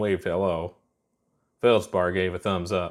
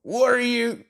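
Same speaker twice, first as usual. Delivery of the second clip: high yelling question; hollered; 3 dB louder than usual. A narrator's reading. Feldspar yelling at Ponder as they crash, high holler. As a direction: holler it out in alarm. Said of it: "Feldspar hollered".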